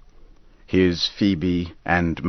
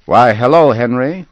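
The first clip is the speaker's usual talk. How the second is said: loud, higher, friendly call of a farmer; hearty farmer greeting; 6 semitones higher, 9 dB louder than usual. Acted. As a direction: hail a neighbour from the cart. Farmer Dodge greets Henry.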